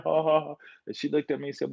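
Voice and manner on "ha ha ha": light spoken ha ha ha, scoffing; mocking laugh. Still laughing at mama about being Indian. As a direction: brush it off with a laugh. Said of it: "laughing at mama".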